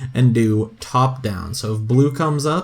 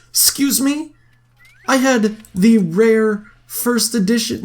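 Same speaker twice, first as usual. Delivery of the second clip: much higher, indignant excuse me; mock outrage; 8 semitones higher than usual. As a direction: act scandalized over the DVDs. Reacting to game character news.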